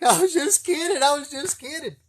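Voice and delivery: high, loud, panicky voice near tears; panicked pleading. Retold or imitated. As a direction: plead like a scared kid caught out.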